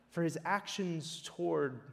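ordinary talk

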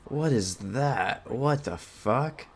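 slow, drawn-out disbelief; real bewilderment. Real, not acted. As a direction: stretch the words out, baffled. Baffled by something in game.

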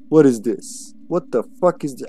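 ordinary delivery

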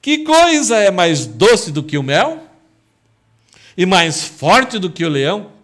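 loud, high, slow gloating proclamation; the townsmen gloating at Samson, loud and slow; triumphant riddle answer. Acted. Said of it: "disseram a Sansão".

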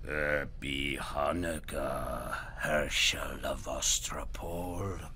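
low, slow, creaking goblin king; bone-cracking voice. Acted. Goblin King greeting Herschel, low and slow. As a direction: drag it out like cracking bones. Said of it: "a voice that sounded like the cracking of bones".

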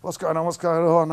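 retold alarm; panicked question. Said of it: "rushed out the back".

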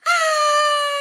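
high loud mock scream. Leader screaming for the crocodile, high mock scream. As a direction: shriek as the croc appears. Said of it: "don't forget to scream".

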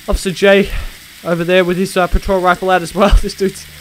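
ordinary delivery

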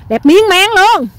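loud, high, slow drawn-out gush; excited hollering. Real, not acted. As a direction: belt it out, stretching each word in delight. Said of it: "Tới khúc này sao em la lớn như vậy em". (la lớn, kéo dài đầy phấn khích)

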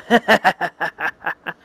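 short hammed-up villain cackle; evil laugh. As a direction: cackle like a cartoon bad guy. Villain laughs after plan.

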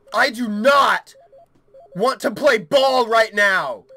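slow, loud, emphatic refusal; exasperated. Real, not acted. Player refusing to play ball, exasperated. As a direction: spell out your refusal, fed up.

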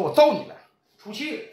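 ordinary delivery

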